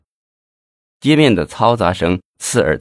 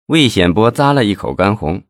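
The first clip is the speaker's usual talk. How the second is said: plain reading voice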